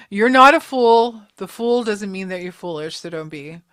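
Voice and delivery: normal talking voice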